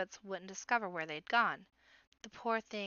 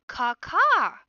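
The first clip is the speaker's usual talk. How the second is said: very high, very loud cawing; baby rabbit shouting; 9 semitones higher, 16 dB louder than usual. A narrator's reading. Little rabbit insisting to his sister, shouting. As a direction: yell the caw insistently. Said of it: "shouting at the top of his voice".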